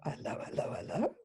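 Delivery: breathy whisper, rising on the last hello; whispered knowing hello. Retold or imitated. Realising she is called adopted, whispering to herself. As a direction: whisper it to yourself, as the penny drops. Noticing what was going on.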